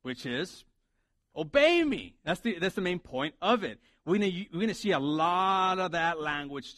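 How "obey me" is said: high, punched "Obey me"; stress on obey me. Shown as a demonstration. Preacher naming the main point, emphatic high "Obey me". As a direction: punch "Obey me" as a raised-pitch command. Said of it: "The emphasis is the first part of it".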